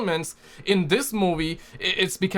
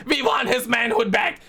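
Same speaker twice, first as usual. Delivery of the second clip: shouting; 6 semitones higher than usual. Real, not acted. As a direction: shout it like a protest chant. Rallying chant about Thor.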